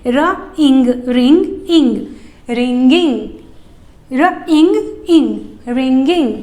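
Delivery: र, इंग, रिंग, then इंग added to make रिंगिंग; playful blending game for children; sound blending. Shown as a demonstration. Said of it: "इंग ही add करना है".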